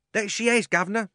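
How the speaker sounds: high, loud cockney onlooker; cheeky bystander. A narrator's reading. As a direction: chime in from the crowd. Crowd member agrees.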